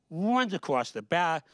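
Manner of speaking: normal talking voice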